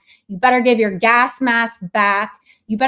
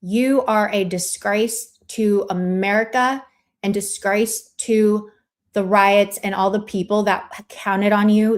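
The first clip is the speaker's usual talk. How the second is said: usual speaking voice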